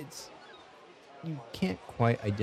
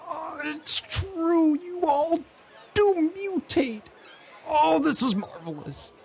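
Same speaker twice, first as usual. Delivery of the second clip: very high, drawn-out gushing delight; overjoyed, stretched-out exclamation; excited squeal; 5 dB louder, slower than usual. Acted. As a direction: gush in a high, slow squeal of amazement. He acts Volo's delight at seeing them.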